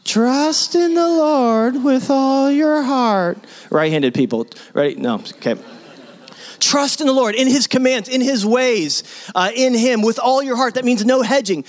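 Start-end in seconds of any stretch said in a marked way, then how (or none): 0.0-3.4 much higher, slow, in a put-on lady's voice; playful high-pitched recitation; high voice for the ladies. Shown as a demonstration. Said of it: "Can I get just the ladies?". 6.6-11.6 higher, urging the congregation; impassioned preaching; fervent emphasis. Real, not acted.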